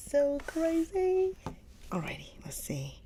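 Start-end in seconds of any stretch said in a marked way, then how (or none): 0.1-1.3 amazed. Real, not acted. Amused by the pronunciation.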